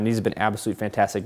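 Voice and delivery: ordinary delivery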